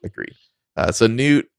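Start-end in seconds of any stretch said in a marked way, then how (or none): 0.0-0.3 much softer, dropped under the breath; muttered agreement. Real, not acted. Agreeing again with the co-host, much softer. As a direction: echo yourself softly, almost to yourself.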